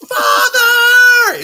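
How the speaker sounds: very high, very loud hammed-up anime scream of Father; over-the-top parody yell; anime shout. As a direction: belt it like a tortured anime hero. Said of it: "a phrase that's shouted in any given fucking anime".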